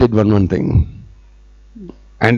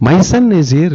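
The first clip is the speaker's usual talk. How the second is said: surprised denial; 6 semitones higher, much faster than usual. Acted. Yashoda denying the complaint.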